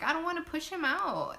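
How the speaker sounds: high-pitched mock arguing; retold whiny protest. Labouring mom arguing with nurse, whiny protest. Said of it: "arguing with my nurse".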